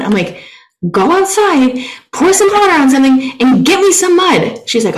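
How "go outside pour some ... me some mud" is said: much louder, higher, laughing-exasperated shout; mock outraged disbelief; screaming. Friend cannot find mud, loud exasperated shouting. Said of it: "I'm screaming so much".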